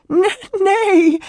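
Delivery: very high, loud stammered wail; tearful denial. A narrator's reading. Weeping woman answering his question, tearful denial. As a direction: sob out the refusal.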